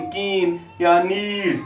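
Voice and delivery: drawn-out ई in नीड; slow demo of the long ई in कीन; stretched long ई. Shown as a demonstration. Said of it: "ई की लंबी आवाज़".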